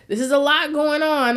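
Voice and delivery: laughing quote to herself; overwhelmed laugh through words. Retold or imitated. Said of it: "I was like".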